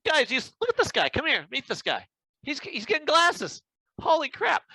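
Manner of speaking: hammed-up "look at this guy!" excitement; retelling a store's over-the-top fuss, mock excited; mock excited crowd. Staff amazed he needs glasses.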